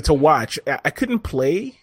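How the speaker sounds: normal talking voice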